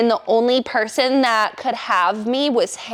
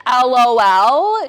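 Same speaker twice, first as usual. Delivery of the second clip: slow, louder stunned repeat; incredulous echo; 2 semitones higher, 5 dB louder, slower than usual. Retold or imitated. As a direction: echo it back in disbelief. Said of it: "she was like".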